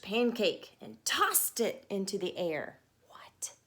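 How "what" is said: breathy, hushed what; a tiny startled whisper; whispered surprise. Real, not acted.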